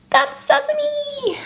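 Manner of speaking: very high, very slow sing-song; sympathetic aww. Real, not acted. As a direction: croon the kitten's name. Sing-song kitten introduction.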